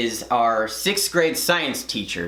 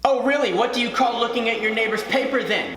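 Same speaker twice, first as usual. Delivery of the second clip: higher, sarcastic scolding imitation; accusing teacher; 6 semitones higher, faster than usual. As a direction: play the teacher pouncing on an excuse. Teacher accuses pupil, acted.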